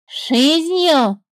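the bird mocking the snake's answer. A narrator's reading. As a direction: mimic it scornfully. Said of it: "передразнила птица".

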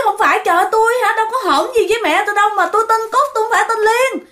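high, rapid acted denial; imitating a woman crying out; hollering in protest. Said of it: "bà Cúc này la làng lên".

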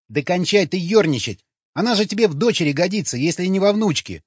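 high, loud, heated rebuke; angry. A narrator's reading. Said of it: "зло проговорил Сквернослов". (зло, на повышенных тонах)

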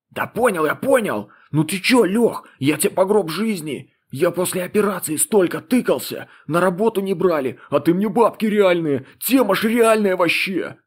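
high, eager and grateful; excited young guy. A narrator's reading. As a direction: gush with thanks, voice up high. Excited young guy thanking friend.

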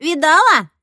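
loud, high yell into the ear; sudden high shout; barked question. A narrator's reading. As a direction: bark it out to startle your friend. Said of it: "гаркнула мне в ухо Марина".

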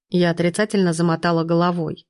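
usual speaking voice

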